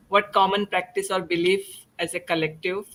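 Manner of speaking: ordinary talk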